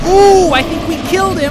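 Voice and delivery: high, loud excited whoop. Real, not acted. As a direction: burst out with glee. Excited about grenade kill.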